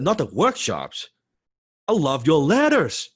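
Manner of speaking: high, slow, emphatic gushing; raving fan. Retold or imitated. Fan telling his idol what he loves, raving emphasis. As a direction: land each word with exaggerated fervour. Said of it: "this guy's raving about his letters".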